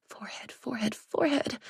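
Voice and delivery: whispered mantra. Acted. Olivia repeating her mantra.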